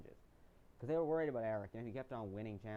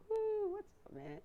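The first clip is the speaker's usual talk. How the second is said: high, loud whooping hello; excited greeting; 6 dB louder, slower than usual. Retold or imitated. Best friend arriving at the loved ones visit, whooping excitedly. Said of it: "he's like".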